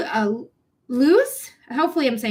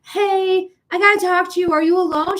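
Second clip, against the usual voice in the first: urgent call; 8 semitones higher, 5 dB louder than usual. Retold or imitated. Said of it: "I was like".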